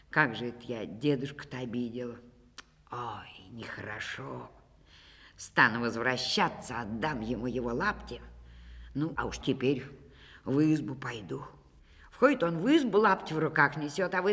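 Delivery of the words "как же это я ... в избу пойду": lower, soft, sorry self-talk; rueful murmur. A narrator's reading. Ivan quietly ashamed of taking grandfather's shoes.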